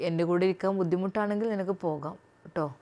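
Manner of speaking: husband speaks gently to his new wife; husband's voice. Acted. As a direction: speak as the soft-spoken husband.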